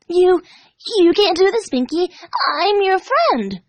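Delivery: high, breathless, frantic; panicked plea. Acted. Dash frantically pleading with the pink pony. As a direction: beg for your life through rising panic. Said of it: "Her mind raced".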